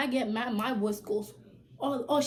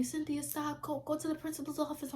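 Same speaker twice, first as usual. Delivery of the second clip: higher, softer teacher voice; stern teacher; 5 semitones higher, 6 dB quieter than usual. Retold or imitated. Teacher grabbing her and ordering her out, lightly voiced teacher. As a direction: play the teacher sending her off. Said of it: "the teachers grabbed me".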